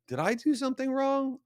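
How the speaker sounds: mock innocent wondering, way up high; comically high, questioning inner voice; high-pitched self-questioning. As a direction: act out your own doubt in a squeaky voice. Voicing own doubts in high voice.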